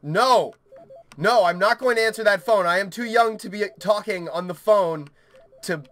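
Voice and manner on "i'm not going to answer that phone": ordinary talk, nothing marked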